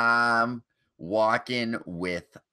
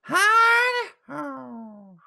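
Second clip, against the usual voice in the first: very high, squeaky mock greeting; 14 semitones higher than usual. Mocking [name] with a squeaky high voice. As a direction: squeak it like a tiny puppy man.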